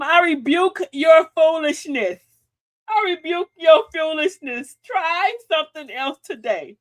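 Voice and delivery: loud, slow, stern rebuke; firm rebuke. Shown as a demonstration. Host telling off a fool, loud and stern. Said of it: "Tell them".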